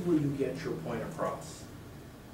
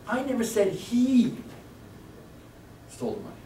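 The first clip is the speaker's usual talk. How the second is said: "I never said he" higher and slowed, rising on "he"; stress on he; 10 semitones higher than usual. Shown as a demonstration. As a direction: climb to "he", then drop. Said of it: "seven different meanings".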